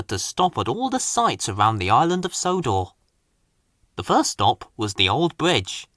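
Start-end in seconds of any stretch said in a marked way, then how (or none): none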